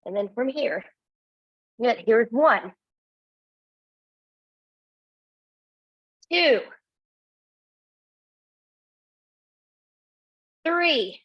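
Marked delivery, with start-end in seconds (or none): 2.0-11.2 counting reps loud. Real, not acted. Instructor pushing class through pikes, called-out count. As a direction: call out each number to push the class.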